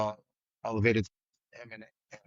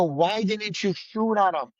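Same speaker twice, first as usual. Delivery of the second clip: retold angry yell; 8 semitones higher than usual. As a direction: confront the team as if yelling again. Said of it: "I'm not trying to yell at people I work with".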